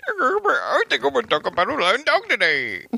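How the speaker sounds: high, put-on voice mocking her; mocking impression. Teasing his partner about inventing a flying dog, high mock voice. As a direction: parody her deciding to make it up.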